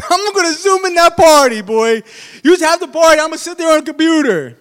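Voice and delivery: high, loud preacher cry; fiery preaching. Acted. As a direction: preach it at full force. Preaching at full force.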